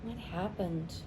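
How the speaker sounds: usual speaking voice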